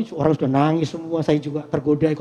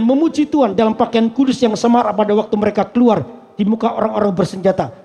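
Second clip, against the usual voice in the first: loud, ringing reading of scripture to the congregation; 6 semitones higher, 7 dB louder than usual. Acted. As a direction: proclaim the Bible passage loudly to the hall.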